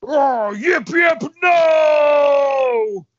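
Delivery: loud, high hammed-up scream; high yelping wail; screaming guard. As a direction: scream in cartoonish agony, drawing out the no. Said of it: "The guard screams".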